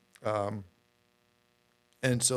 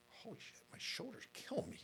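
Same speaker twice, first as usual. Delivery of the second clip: breathy whispered groan of soreness; pained whisper; 14 dB quieter than usual. Retold or imitated. Waking at night, whispering about his aching shoulders. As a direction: whisper it, stiff and sore at 3 AM.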